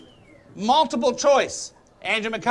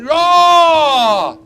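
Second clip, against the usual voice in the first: loud high battle roar; viking roar; 7 semitones higher, 7 dB louder than usual. Shown as a demonstration. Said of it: "make a sound like a diehard".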